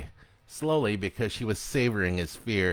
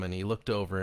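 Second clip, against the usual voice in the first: usual speaking voice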